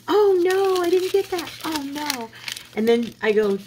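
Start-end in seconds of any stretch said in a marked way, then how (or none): 0.1-2.3 high, bright mock cashier voice, startled oh no; flustered young cashier. Retelling the cashier's reaction to the missed sale price, high and startled. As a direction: pitch way up like the caught-out girl at the register. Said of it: "And she goes".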